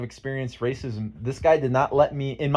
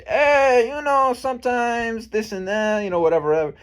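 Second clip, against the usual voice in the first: mocking imitation; 10 semitones higher, 3 dB louder than usual. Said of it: "He goes".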